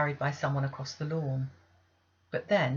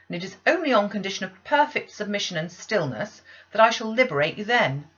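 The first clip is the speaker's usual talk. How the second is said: stern aunt; 4 semitones higher, 8 dB louder than usual. Acted. Aunt punishing the child.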